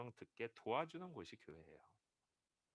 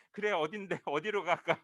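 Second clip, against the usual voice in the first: higher, louder teasing with a laugh; playful laughing reply; 7 semitones higher, 11 dB louder than usual. Retold or imitated. 아내가 취한 청년에게 장난치며, 웃으며 맞받아. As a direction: play along and laugh. Wife plays along, laughing.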